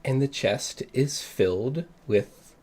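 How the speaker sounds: slow, hushed reveal; slow suspenseful narration. A narrator's reading. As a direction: build suspense slowly. GM slow dramatic description.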